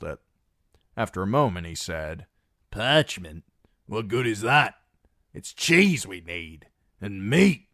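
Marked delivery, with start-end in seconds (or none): 2.7-7.7 louder, rough scornful voice; scoffing hungry ironman. Acted. A starving cod mocking the letter, louder and gruff. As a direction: scoff at the paper.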